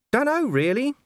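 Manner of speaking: high shrugging dog voice; small dog voice. Acted. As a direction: shrug it off like a scruffy mutt. Gaspode answers.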